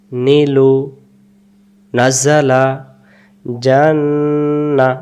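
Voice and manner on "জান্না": জান্না stretched very long on the vowels; জান্না said very slowly with a long falling end; drawn-out jannah. Shown as a demonstration. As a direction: hold জান্না slowly, teacher modelling. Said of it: "আরেকবার".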